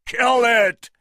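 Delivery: loud, high order; shouted. A narrator's reading. Engelhorn ordering his men, a loud high shout. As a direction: bark the kill order. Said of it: "shouted Engelhorn".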